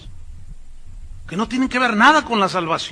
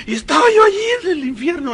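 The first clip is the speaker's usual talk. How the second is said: high, tearful mock testimony; hammed-up girlish wail; young woman narrating; 9 semitones higher, 4 dB louder, faster than usual. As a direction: imitate a girl's overwrought testimony, high and hurried. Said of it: "aparentemente es una joven narrando".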